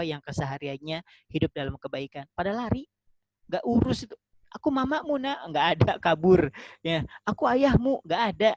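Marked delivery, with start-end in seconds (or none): 4.5-5.5 put-on high-pitched mother calling her child; pleading mother. Mother calling her child who runs away at Mahsyar, high mock plea. 7.2-8.0 high, loud acted father's call. Father calling his child who runs away at Mahsyar, high loud call.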